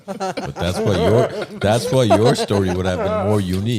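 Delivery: laughing. Real, not acted. Teasing friend about his story.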